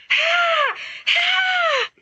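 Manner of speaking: two very high shrieking screams; repeated scream. Acted. Carmina still shrieking at the armor, repeated high screams. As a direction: keep shrieking in panic. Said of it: "Carmina screams".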